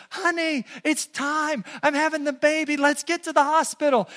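high-pitched excited wife; happy, slightly freaking out. Acted. Wife phoning her husband as labour starts, excited panic. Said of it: "slightly happy, slightly freaking out".

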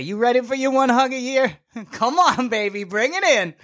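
high, laughing invitation to a hug; laughing tease. Retold or imitated. Teasing his non-hugger uncle with a high laughing voice. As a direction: coax him in with a laugh.

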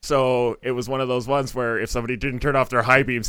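usual speaking voice

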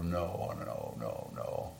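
ordinary talk, nothing marked

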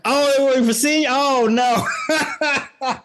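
fast, high falsetto with laughter; high squeaky voice. Acted.

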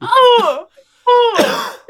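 high imitated wail; two high, mock-distressed "oh" cries. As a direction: cry out high like a startled baby, in jest. Mimicking the baby's scream at Santa.

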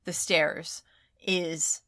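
ordinary delivery

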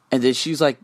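ordinary talk

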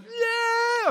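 mock yelp of pain, way up high; imitated cry of pain. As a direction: yelp like a hurt kid. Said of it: "Landon's like".

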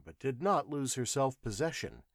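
usual speaking voice

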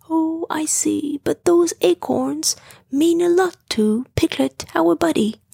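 plain reading voice

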